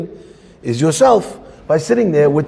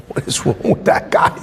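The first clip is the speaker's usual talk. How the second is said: whispered aside; 3 dB quieter than usual. Retold or imitated.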